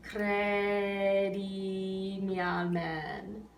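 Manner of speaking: "Credimi al men" slow, "mi al" joined on one note; spoken in rhythm. Shown as a demonstration. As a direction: speak "Credimi al men" slowly, linking mi and al. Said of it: "So we say".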